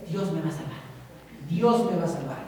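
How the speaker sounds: calmly trusting God amid the flood; serene believer. Acted. (creyente sereno, más grave y muy suave)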